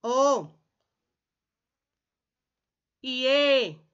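"O", then "E", each long and falling; demonstrated open vowels. Shown as a demonstration. Teacher leading pupils through open vowels, each one held. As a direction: sound out "O" and "E" separately, held open.

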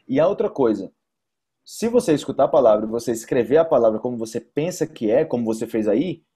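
ordinary delivery

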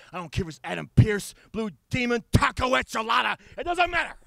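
high, loud, fast shouting. Acted. Wrestler shouting he'll fight anyone. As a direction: yell the names.

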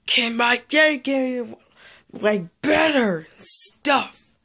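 high, loud, slow whiny complaint; exasperated whine. Acted. The player groans at the returning enemy, high slow whine. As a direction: stretch it out in exasperation. Enemy shows up yet again.

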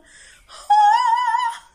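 sudden high, sustained sung wail; loud operatic high note. Pretend. Singer shows off on announcing opera, loud high operatic note. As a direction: burst into one piercing opera note.

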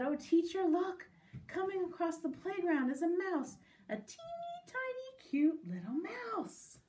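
put-on high voice rising to teeny-tiny squeak; excited child. Child showing teacher a mouse, excited high voice.

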